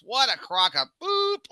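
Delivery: high, slow scornful heckle; a heckler's scoffing grumble; mock scoff. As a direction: sneer it from the back row. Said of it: "my brother in the back goes".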